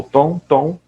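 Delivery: lightly imitating the mother yelling Tom; a half-hearted call for the boy; mock yell. Teacher retelling how the mother shouted for Tom, mild mock yell. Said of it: "ela gritou".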